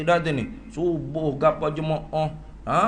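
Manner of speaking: normal talking voice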